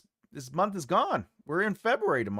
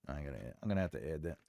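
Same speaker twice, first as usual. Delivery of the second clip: low, quick and soft; a hushed note to self; muttering to self; 11 semitones lower, 12 dB quieter, much faster than usual. Real, not acted. Streamer reminding himself to add a book, muttered. Same words, muttered first.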